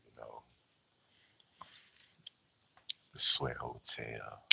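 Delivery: a hint of low crooner fading to hush.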